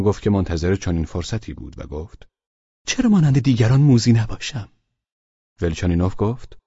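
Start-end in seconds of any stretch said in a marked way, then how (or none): 2.9-4.7 higher, sharper comeback; spring up and fire it back; mildly raised retort. Acted. Pavel Pavlovich jumping up to retort to Velchaninov, sharply.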